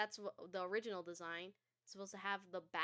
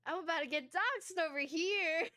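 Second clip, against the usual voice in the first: laughing; 9 semitones higher, 7 dB louder than usual. Real, not acted. Laughing after jump scare.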